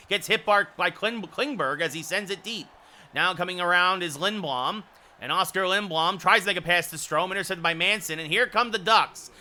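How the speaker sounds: fast, rising live hockey call; rapid hockey play-by-play. Acted. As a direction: call the action as it happens.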